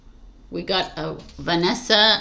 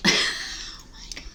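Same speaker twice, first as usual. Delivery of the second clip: slow, breathy laughing whisper; 6 dB quieter, slower than usual. Real, not acted. Giggling at her own blooper, in a whisper.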